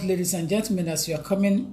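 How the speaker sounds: normal talking voice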